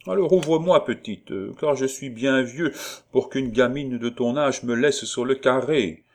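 high, loud appeal through a door; pleading old colonel. Acted. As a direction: playfully beg to be let in.

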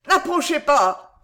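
high, loud panicked cry; terrified howl. A narrator's reading. Madman orders his torturer back, howling in terror. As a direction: fend him off in utter terror. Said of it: "hurla le fou au comble de l'épouvante".

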